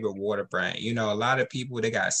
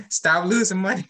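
scolding yell; 7 semitones higher, 4 dB louder than usual. Real, not acted. Host yelling at listeners to stop losing money. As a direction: raise it and push it high.

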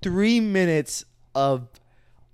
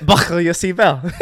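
flight attendant announcement; 6 dB louder than usual. Pretend. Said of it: "That's from T O G".

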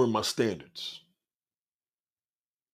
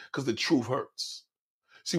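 normal talking voice

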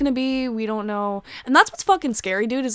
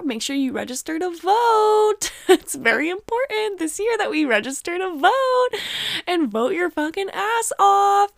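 pleading insistence; 10 semitones higher, slower than usual. Real, not acted. Urging listeners to vote.

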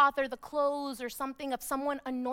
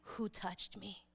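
hushed, slow question, far below the preaching voice; softly asking Jesus; 7 semitones lower, 14 dB quieter, slower than usual. Acted. Jesus asks the crowd who touched him, softly. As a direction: ask it low and very quietly. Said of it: "he was like".

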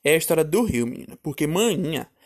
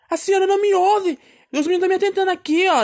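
high-pitched child's yell, acted out; shouting at mother; 13 semitones higher, 4 dB louder than usual. Child telling mother the boys are pestering, retold shout. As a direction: re-enact a high, wailing shouted complaint. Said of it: "que eu gritei com ela".

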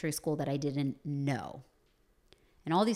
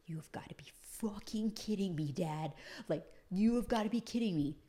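mortified whisper; 3 semitones higher, 5 dB quieter than usual. Retold or imitated. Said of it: "I'm sitting there like".

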